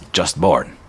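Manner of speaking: lightly voiced gruff dwarf. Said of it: "That's a good dwarf voice".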